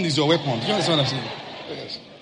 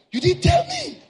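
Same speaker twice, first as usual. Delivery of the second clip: very high, astonished cry; shocked exclamation; 4 dB louder than usual. Retold or imitated. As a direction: sound caught out and amazed. Shocked at friend's shoe price.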